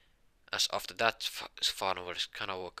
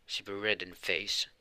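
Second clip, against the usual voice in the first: ordinary delivery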